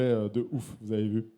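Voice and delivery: ordinary talk, nothing marked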